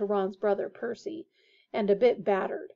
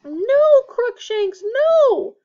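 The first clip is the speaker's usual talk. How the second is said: high, loud desperate cry; 13 semitones higher, 12 dB louder, much slower than usual. A narrator's reading. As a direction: cry out to stop the cat. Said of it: "cried the witch".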